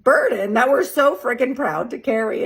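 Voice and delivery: chuckling through the words, real amusement; laughing. Real, not acted. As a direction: laugh as it hits home. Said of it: "sorry that I'm laughing".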